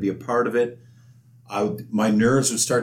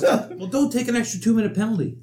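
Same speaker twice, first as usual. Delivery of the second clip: high-voiced coach; 12 semitones higher than usual. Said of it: "Dave's got a very high voice".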